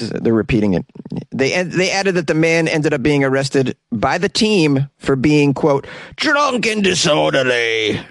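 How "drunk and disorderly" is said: slow, drawn-out mock Sussex accent; attempted Sussex accent. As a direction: stretch it out in a comic English accent from Sussex. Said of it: "I don't know if I got that accent correct".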